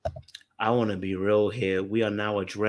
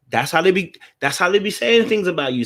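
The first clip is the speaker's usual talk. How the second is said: louder, higher insistence; heated; 7 semitones higher, 6 dB louder than usual. Real, not acted. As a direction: press the point hard, sis. Co-host scolding guest.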